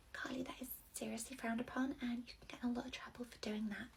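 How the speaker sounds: gasp, then a low scandalised whisper; nursery worker whispering a warning to her colleague; shocked whisper. Acted. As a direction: whisper the scolding so nobody overhears.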